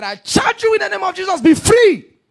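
very loud, high charge; commanding deliverance shout. Retold or imitated. Pastor commanding the mad woman to be free, full shout.

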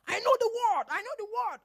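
high, loud defensive protest; mock indignant boyfriend. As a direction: play the offended man insisting.

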